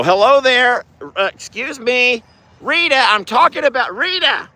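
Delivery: high-pitched call to an animal; calling to a horse. Real, not acted. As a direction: pipe up high to get her attention.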